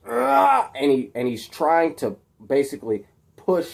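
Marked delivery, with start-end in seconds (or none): 0.1-0.7 high, loud straining growl; straining grunt. Acted. Peter struggling to push out of the grips, straining grunt. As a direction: grunt as you fight the restraints. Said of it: "he goes".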